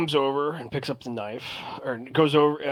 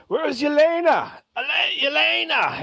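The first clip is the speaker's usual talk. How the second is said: high, loud calling out for the waitress; impatient calling; 13 semitones higher, 6 dB louder than usual. Acted. New customers impatiently calling for Yelena, loud and high. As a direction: shout across the room, impatient. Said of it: "They're like".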